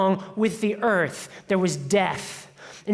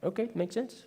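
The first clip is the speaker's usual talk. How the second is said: shrugging agreement; 11 dB quieter than usual. Retold or imitated. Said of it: "everyone's like".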